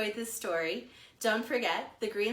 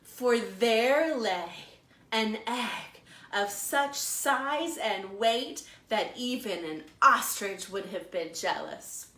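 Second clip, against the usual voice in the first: slow, lively storytime narration; animated picture-book storytelling; reading to children; 3 semitones higher, 3 dB louder, slower than usual. A narrator's reading. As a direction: read it like a librarian to little kids. Librarian reads picture book aloud.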